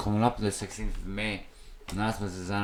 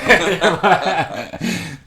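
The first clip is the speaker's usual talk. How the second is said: a big loud burst of laughter; 7 semitones higher, 10 dB louder than usual. Real, not acted. Cracking up loud at the wake joke.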